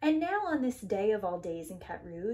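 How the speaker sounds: usual speaking voice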